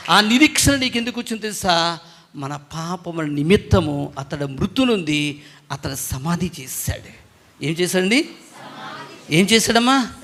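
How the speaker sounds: ordinary talk, nothing marked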